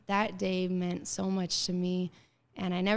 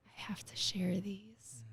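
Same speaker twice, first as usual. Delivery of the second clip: awed whisper; 8 dB quieter than usual. Retold or imitated. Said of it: "And I was like".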